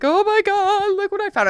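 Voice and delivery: high, breathless excited exclaim to dad. Retold or imitated. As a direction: gush it out, can't believe the find.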